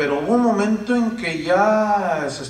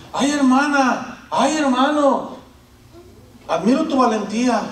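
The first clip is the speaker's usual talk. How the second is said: mock flattery; 7 semitones higher, 5 dB louder than usual. Pastor mimicking online flatterers, high and loud. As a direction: ham up a syrupy admirer.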